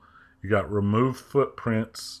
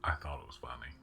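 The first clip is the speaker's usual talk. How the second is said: whispering; 8 semitones lower, 12 dB quieter, faster than usual. Real, not acted. Quiet whispered aside.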